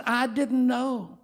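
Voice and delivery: high, slow pleading voice; earnest plea. Retold or imitated. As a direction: beg him to believe you. Abigail pleading.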